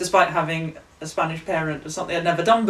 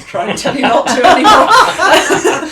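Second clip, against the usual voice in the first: louder, higher, laughing as she quotes; laughing through the quote; 10 semitones higher, 11 dB louder than usual. Retold or imitated. Retelling how the gauchos gave up on her, laughing out the quote. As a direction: giggle while giving in.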